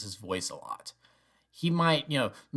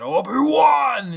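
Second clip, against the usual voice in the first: long, much higher, louder shout; anguished yell; 13 semitones higher, 10 dB louder, much slower than usual. Retold or imitated. Fan imitating Vader yelling after Obi-Wan, loud and drawn out. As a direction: cry out the name, stretched slow. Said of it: "crescendos more into James Earl Jones".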